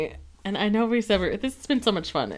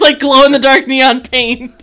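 laughing; 3 semitones higher, 10 dB louder than usual. Real, not acted. Laughing joke, loud.

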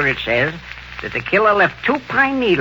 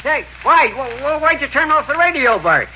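put-on excited old man; 9 semitones higher than usual. Old clerk protests.

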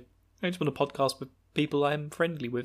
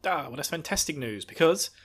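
ordinary talk

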